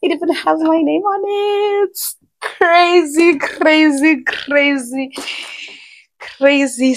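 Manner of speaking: high, giddy and excited; fading from giddy high pitch to normal; thrilled. Real, not acted. Seeing her name on the box, gushing excitedly.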